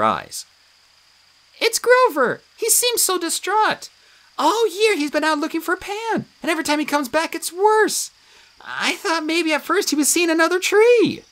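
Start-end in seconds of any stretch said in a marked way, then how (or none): 1.6-11.2 high, fretful, near tears; a lightly voiced upset nymph; worried nymph. A narrator's reading. Tree nymph fretting about Grover to friends, near tears. Juniper worrying, then crying.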